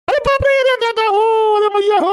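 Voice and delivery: high, howling cry of pain; hammed-up old man bawling under blows; wailing old man. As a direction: wail for your mother as the stick lands. Old man beaten with a stick.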